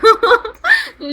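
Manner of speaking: a quick happy laugh; bright giggle. Real, not acted. Laughing at baby.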